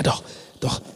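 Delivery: usual speaking voice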